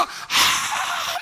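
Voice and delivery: long, very high agonized "aah"; loud drawn-out mock wail of torment; anguished wail. Peter tormented after denying his Lord, anguished wail. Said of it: "막 괴로워하다가".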